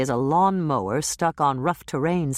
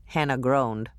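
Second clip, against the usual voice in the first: ordinary delivery, nothing marked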